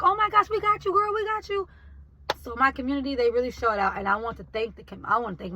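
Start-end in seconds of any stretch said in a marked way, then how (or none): 0.0-1.7 excited customers. Retold or imitated. Customers cheering her on.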